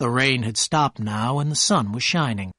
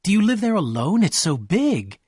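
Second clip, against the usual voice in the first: excited; 6 semitones higher than usual. A narrator's reading. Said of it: "she cried excitedly".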